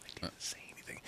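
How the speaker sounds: whispered, no voice at all. Pretend. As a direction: whisper it like a guilty aside. Feigning innocence after a joke.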